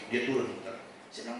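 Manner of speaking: ordinary delivery, nothing marked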